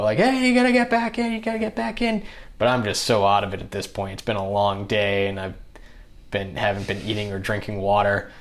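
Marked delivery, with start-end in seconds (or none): 0.2-2.2 much higher, urgent alarmed calls; mock panicked shout to come back; freaking out. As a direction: crew panicking as the rower jumps boats, high and urgent. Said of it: "they're freaking out".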